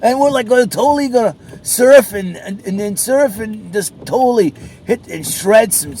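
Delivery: high, loud surfer-dude voice; mock surfer dude hyped about waves; California surfer dude. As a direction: ham up a stoked beach bro. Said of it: "like some crazy California dude".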